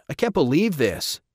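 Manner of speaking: high, frustrated outburst; frustrated disbelief. Acted. Rob reeling from losing his team, frustrated. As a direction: let the disbelief burst out. Son dismayed at bad news.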